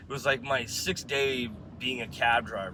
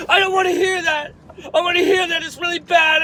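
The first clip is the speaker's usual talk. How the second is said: high, very loud tearful shout, imitated; hammed-up hysterical yelling; mock screaming; 11 dB louder than usual. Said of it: "the girl started yelling and screaming at me".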